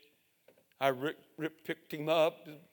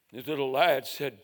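normal talking voice

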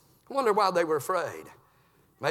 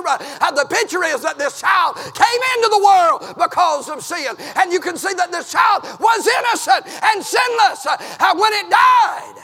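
loud, high preaching holler; full-force revival preaching; 9 semitones higher, 8 dB louder than usual. Acted. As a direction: hammer it home from the pulpit.

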